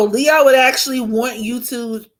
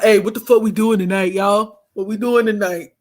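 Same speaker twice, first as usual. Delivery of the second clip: tough friend voice. Retold or imitated. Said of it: "she like".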